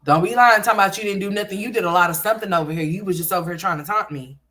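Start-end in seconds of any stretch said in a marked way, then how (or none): 0.0-1.8 playful scolding. Acted. Scolding her son playfully.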